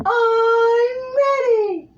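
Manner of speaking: very high, slow, crying squeal; SpongeBob voice. Shown as a demonstration. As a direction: push it through a tight throat. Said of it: "coming from the chest and through the throat".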